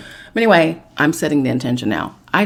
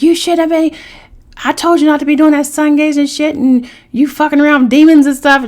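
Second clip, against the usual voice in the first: much higher, louder telling-off; husband berating her; angry scolding; 10 semitones higher, 7 dB louder than usual. Retold or imitated. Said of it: "So he's like".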